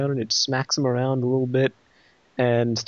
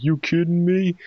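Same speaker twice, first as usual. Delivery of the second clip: higher, louder incredulous retort; scoffing disbelief; 6 semitones higher, 5 dB louder than usual. Acted. Bulk rejects Breeze's plan to hold fire, loud scoffing disbelief. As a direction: throw it back loud and incredulous.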